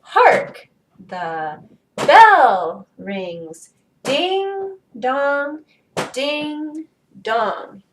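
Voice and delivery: "Hark" and "bell" loud, "the" and "rings" passed over lightly; slow, stressed reading. Shown as a demonstration. Teacher cueing pupil to point, stressing "Hark", "bell" and "Ding". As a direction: punch "Hark" and "bell", lift each "Ding", spaced out. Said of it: "point to the strong one as I say them".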